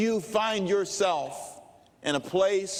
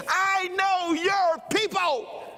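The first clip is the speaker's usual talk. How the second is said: high, drawn-out holler; excited Rafiki-like old man; 12 semitones higher, 3 dB louder, slower than usual. Retold or imitated. Old man tells the preacher he knows his family, high holler. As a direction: cry it out slowly like an excitable wise baboon. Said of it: "that's what he's like".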